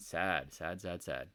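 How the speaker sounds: mournful little lament; a touch of mock sorrow; mock sad.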